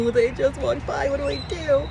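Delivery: freaking out. Real, not acted. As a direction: blurt it out in giddy panic. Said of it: "I'm freaking out".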